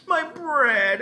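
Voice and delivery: desperate scream. A narrator's reading. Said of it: "Ellen screamed".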